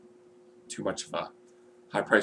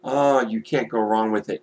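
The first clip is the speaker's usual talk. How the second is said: usual speaking voice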